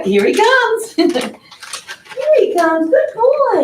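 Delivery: pet talk. Real, not acted. Calling the cat over.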